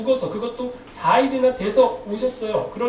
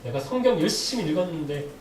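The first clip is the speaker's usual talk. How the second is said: mock sulking in a lowered voice; sulky grumble; 6 semitones lower than usual. Preacher acting a believer let down after praying hard, mock sulking. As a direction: imitate a huffy believer, dropping low at each threat. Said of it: "이렇게 삐져요".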